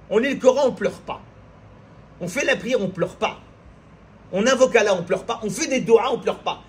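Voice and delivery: impassioned Friday sermon at full force; fiery preaching. Acted. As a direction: preach it hard to the congregation. Preacher exhorts the congregation.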